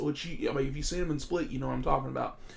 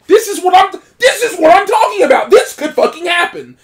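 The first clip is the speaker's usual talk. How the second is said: much higher, loud, worked-up yelling; hyped-up quoted yell; excited shout; 17 dB louder than usual. Retold or imitated. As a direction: shout it like you've been proven right. Retelling own reaction watching film.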